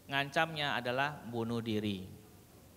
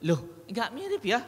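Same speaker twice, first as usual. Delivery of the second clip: retold puzzled surprise; 8 semitones higher, 4 dB louder than usual. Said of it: "dia bilang".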